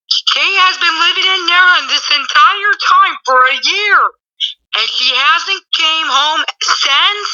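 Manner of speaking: mock outrage. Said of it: "she gets kinda mad".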